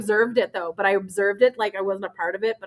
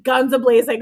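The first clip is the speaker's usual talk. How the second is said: ordinary talk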